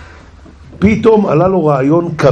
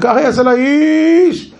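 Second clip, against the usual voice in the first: slow, higher herald's cry; proclaiming shout; 10 semitones higher, much slower than usual. Acted. Haman heralding Mordecai on the horse, slow high cry. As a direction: call it out to the whole street. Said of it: "וזה צועק".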